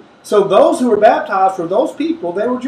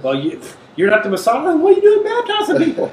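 mock-baffled challenge shooting up high; high-pitched, hammed-up bewilderment; incredulous questioners; 9 semitones higher, faster than usual. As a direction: question them in exaggerated disbelief, rushing the end. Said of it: "they inquire Jimmy about his baptism".

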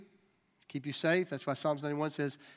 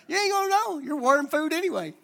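teasing; 10 semitones higher, 5 dB louder than usual. Retold or imitated. Retold joke to friend.

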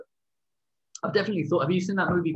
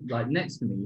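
ordinary talk

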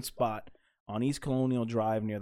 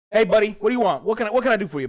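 loud, higher mock Italian accent, friendly; Italian waiter accent; 6 semitones higher, 5 dB louder, faster than usual. Imitating the friendly old Italian waiter to his friends, a loud, higher mock accent. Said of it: "thick accent".

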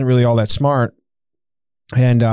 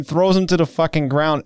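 ordinary delivery

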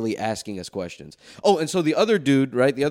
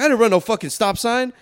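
defensive driver; 9 semitones higher, 4 dB louder than usual. Retold or imitated. Other driver denying, voiced.

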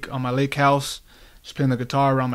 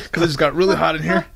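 laughing; 4 semitones higher, 3 dB louder than usual. Real, not acted. Laughing through explanation.

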